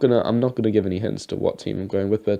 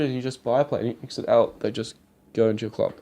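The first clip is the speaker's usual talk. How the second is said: normal talking voice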